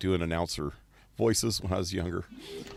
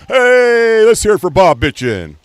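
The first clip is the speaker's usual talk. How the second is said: booming, high, drawn-out host voice; very loud, very slow over-the-top showman; game show host; 12 semitones higher, 14 dB louder than usual. Retold or imitated. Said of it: "he talked like that".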